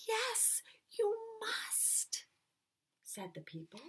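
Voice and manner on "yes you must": high whispered firm reply; whispered insistence. Acted. Townsfolk whispering that Carolina must go. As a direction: insist in a hush.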